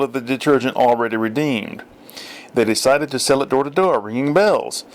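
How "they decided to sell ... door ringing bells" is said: plain reading voice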